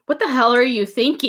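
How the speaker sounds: high-pitched, lightly acted telling-off; retold scolding, pitched well up; mock indignation. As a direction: scold him in mock disbelief. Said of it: "instantly gets mad at him".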